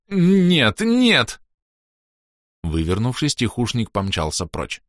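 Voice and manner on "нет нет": panicked no. A narrator's reading. Rogue panics.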